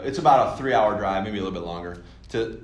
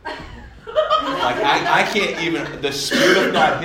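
high, louder laughing burst; overwhelmed laugh; 6 semitones higher, 6 dB louder, much slower than usual. Retold or imitated. Telling the church how God's Spirit hit him, laughing overwhelmed.

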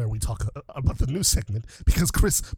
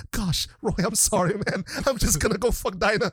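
ordinary delivery

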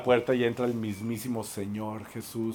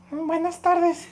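squeaky, much higher mock-innocent hello; nervous innocent greeting; much slower than usual. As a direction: greet the cop like a scared kid. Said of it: "Y yo así".

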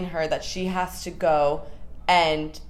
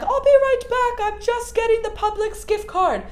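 put-on much higher, sugary little-old-lady voice; sweet old woman voice. Imitating grandma on the phone to the scammer in a high sweet old-lady voice. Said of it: "like, a sweet old woman voice".

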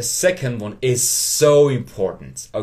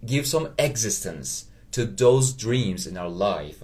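ordinary talk

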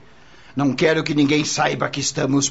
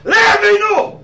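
higher, louder full-throated roar; roaring tyrant king; 8 dB louder, slower than usual. Acted. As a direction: scream the order with all your might. Lord repeats order, louder still.

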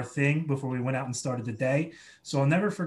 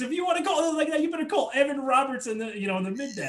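much higher mock host; radio host roasting the caller, high-pitched; 12 semitones higher, faster than usual. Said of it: "He goes".

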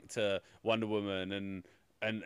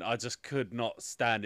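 ordinary delivery

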